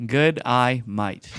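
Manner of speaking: slow, separated words. Shown as a demonstration. Podcaster obediently repeats three words one by one, slow and deliberate. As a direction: read each word slowly on its own. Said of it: "try saying these three words and not sounding like an Australian".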